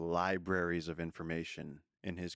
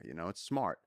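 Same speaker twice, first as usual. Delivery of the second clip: ordinary talk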